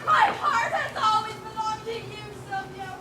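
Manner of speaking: slow, loud, high, half laughing half sobbing; milk the melodrama; mock-tearful loud gush; overwrought vow.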